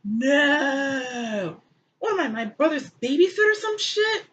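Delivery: high, indignant put-on whine; hammed-up high protest; mock defensive Cain. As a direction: deflect like a guilty teen. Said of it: "Cain is like".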